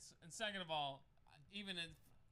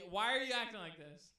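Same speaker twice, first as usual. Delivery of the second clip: higher, louder retold protest; exasperated raised voice; 5 semitones higher, 10 dB louder than usual. Challenging the angry stranger at the fence, loudly exasperated. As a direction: call it out like you're acting out a quarrel. Retelling his reply in the quarrel.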